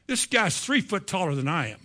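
ordinary talk, nothing marked

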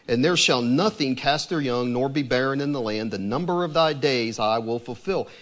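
measured, solemn reading of scripture; steady, reverent Bible reading. Acted. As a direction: read it like holy writ, deliberate. Reads scripture passage aloud.

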